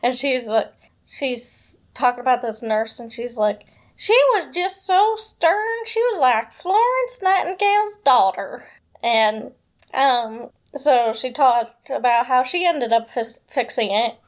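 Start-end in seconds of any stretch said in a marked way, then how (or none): 3.9-8.6 southern accent impression. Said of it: "attempt to do an impression of her".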